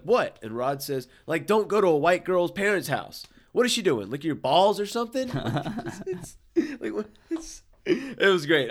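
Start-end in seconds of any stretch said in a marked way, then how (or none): 0.0-0.4 surprised what. Retold or imitated. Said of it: "Chris says, like". 1.5-5.3 high, loud exasperated buddy voice; Rod impression. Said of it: "I didn't deliver the cadence correctly". 5.3-8.7 laughing hard. Real, not acted. Cracking up at own quote.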